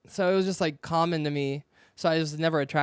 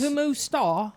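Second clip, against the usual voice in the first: British accent; 7 semitones higher, slower than usual. Shown as a demonstration. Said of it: "How do you say como est-, como está in a British accent?".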